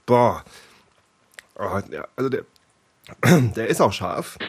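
strained voice after coughing; catching breath after a sip went down wrong; choking on a drink. Real, not acted. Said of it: "in den falschen Hals gekriegt".

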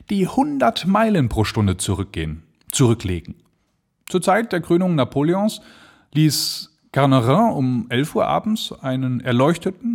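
viel höher, schwärmerisch; enthusiastic stranger. Acted. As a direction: redet sich in Begeisterung. Stranger raves about balloon flights. (der Unbekannte zum Erzähler, schwärmend)

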